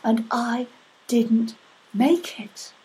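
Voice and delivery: lightly voiced goddess, delighted and amazed; a hint of a wondering goddess. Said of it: "And the Elohim said".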